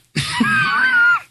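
a big high burst of laughter. Real, not acted. Host laughs at tangent rant.

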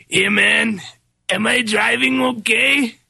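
slow, spaced-out stoner drawl. Retold or imitated. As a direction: play a baked stoner at the wheel. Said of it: "the genius of [name] and [name]".

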